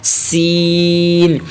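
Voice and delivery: سین with a long whistling s; drawn-out whistled سین; whistling seen. Shown as a demonstration. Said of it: "سیٹی کی آواز".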